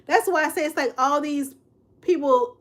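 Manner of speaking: ordinary delivery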